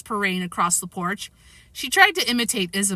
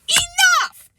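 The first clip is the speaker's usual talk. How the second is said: very high, loud scolding shout; 6 dB louder than usual. A narrator's reading. Grandma halting the breakfast fight, shouting. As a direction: shout to put a stop to it. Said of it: "Grandma shouted".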